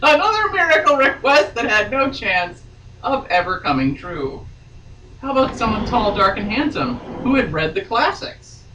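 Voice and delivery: steady read-aloud novel voice; story narration. A narrator's reading. Reading novel aloud on podcast.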